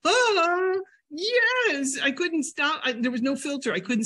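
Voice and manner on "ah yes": high, delighted gasp of yes; a much higher, giddy yes to cream cheese; eager yes. Retold or imitated. Accepting the new cream cheese, high delighted yes. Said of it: "And I'm like".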